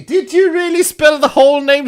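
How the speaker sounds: high, loud disbelief; incredulous. Real, not acted. Streamer asking about the long team name, incredulous. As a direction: pitch shooting up in amazed challenge.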